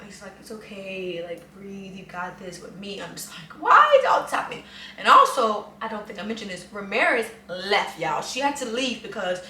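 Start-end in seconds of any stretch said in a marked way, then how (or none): 0.4-3.0 soft, soothing retold comfort; quiet, reassuring voice imitating the friend; calming reassurance. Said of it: "trying to calm me down". 3.6-4.6 high, loud, fast meltdown; hammed-up freak-out, pitch leaping up; panicked wail. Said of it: "trying to calm me down".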